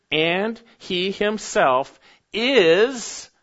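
slow "And he himself is" with rising "is"; stress on is. Shown as a demonstration. Preacher stressing the present tense to the congregation, slow. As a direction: stretch and lift "is" to show it is ongoing. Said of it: "present tense, continually, habitually".

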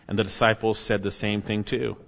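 ordinary talk

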